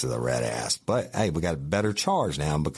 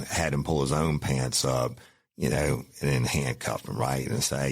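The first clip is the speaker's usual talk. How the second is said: ordinary delivery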